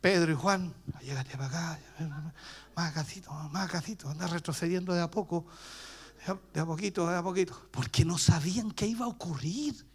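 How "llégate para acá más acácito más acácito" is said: en voz baja, temeroso; cuchicheo nervioso. Retold or imitated. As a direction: se llaman bajito retrocediendo. Discípulos asustados se apartan. (discípulos asustados se hablan bajito)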